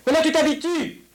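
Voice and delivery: high yelled encouragement; shouting. Acted. Telling the child they are getting used to it, shouting. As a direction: keep yelling as papa coaching a child. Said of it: "je ne crie que pour te faire".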